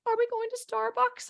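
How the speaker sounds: put-on high-pitched small child's question; little girl asking. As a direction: ask it like a hopeful nine-year-old. Nine-year-old daughter asking, acted.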